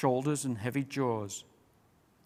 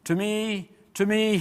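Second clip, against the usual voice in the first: higher, louder rallying call; 7 semitones higher, 5 dB louder than usual. A narrator's reading. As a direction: summon the animals to the king. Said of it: "he called".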